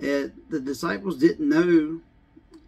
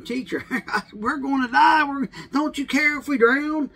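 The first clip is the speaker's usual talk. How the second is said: high, frantic cry of terrified sailors; panicked disciples; 11 semitones higher, 4 dB louder than usual. Acted. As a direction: beg the teacher, half laughing in panic.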